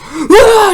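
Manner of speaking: sudden high, loud "Ah!"; imitated scare shout. [name] startling the little guy, sudden high mock scream. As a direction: yell it to make someone jump.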